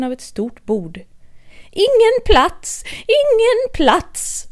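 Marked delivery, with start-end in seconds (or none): 1.7-4.5 high, slow, calling-out Mad Hatter voice; shouting no room. Acted. Hatter and Hare shouting at Alice, high and drawn out. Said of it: "ropade de".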